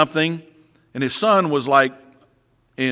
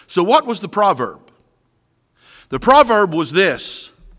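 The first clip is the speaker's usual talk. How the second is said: ordinary talk, nothing marked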